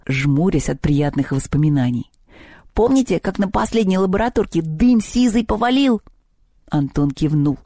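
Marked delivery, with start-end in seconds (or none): 2.8-6.0 lightly voiced high, gleeful goblin; a touch of goblin pride, pitched up; boastful house goblin. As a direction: recall your prank with relish.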